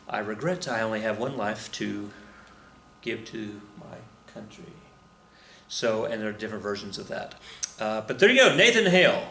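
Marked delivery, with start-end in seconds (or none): 0.1-4.7 softer, trailing solemn repeat; solemn quiet. Retold or imitated. Repeating a spy's last words softly, solemn. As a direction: let the last words fade out with reverence. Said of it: "the standard line is".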